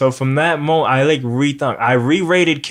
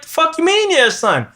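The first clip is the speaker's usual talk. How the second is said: incredulous outrage. Retold or imitated. Indignant retort to villain.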